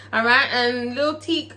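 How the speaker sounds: normal talking voice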